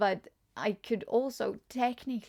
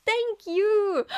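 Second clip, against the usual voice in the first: high and loud thank you; real delight; gleeful thanks; squealed thanks; 11 semitones higher, 8 dB louder than usual. Real, not acted. Thanking chat for cute gift.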